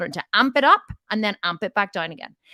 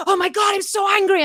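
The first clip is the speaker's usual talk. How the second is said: much higher, loud mock rage; mock furious shout; 12 semitones higher, 6 dB louder than usual. Hammed-up shout of fury. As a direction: client at anger's peak, yelling to coach in mock rage. Client at peak of anger.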